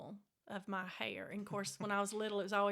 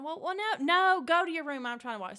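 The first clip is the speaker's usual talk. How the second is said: high, much louder order; mama insisting; 7 semitones higher, 10 dB louder than usual. Retold or imitated. Mother insisting the kids leave her TV alone, loud. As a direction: shout them off to their room.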